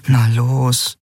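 will her on under your breath; silent pleading. A narrator's reading. Hidden Nelen urging the pirate woman to leave, silent pleading. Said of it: "flehte Nelen stumm".

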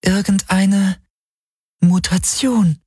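high, slow, drawn-out female voice; regretful Helix voice. A narrator's reading. The Shadow Helix guessing for Galen, regretful. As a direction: stretch the words out high and regretfully. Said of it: "Sie klang bedauernd".

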